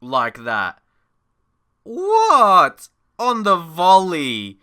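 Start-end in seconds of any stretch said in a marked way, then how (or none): 1.8-4.6 high, loud and slow in awe; awed cry at the volley; amazed shout. Real, not acted. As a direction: shout it out in wonder. Replay of the goal.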